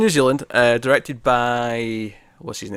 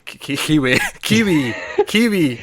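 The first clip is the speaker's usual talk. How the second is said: Kiwi said higher in a strange put-on accent; odd Kiwi accent attempt; 4 semitones higher than usual. Shown as a demonstration. Trying a Kiwi accent on a friend, jokingly. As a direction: repeat Kiwi trying out a New Zealand sound. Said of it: "I don't know what accent I, that sounded like there".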